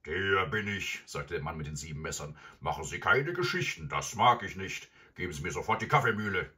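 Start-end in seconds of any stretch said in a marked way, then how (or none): 0.0-1.0 loud, self-important villain; gruff robber. Acted. Robber confirms his name. 2.6-6.5 loud villain giving orders; gruff threatening robber. Acted. Robber figure in read story.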